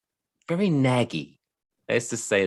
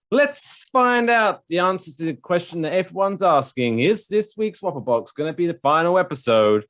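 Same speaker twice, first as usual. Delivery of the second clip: announcer tease; 8 semitones higher than usual. Acted. Hyped segment announcement.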